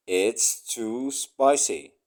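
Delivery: normal talking voice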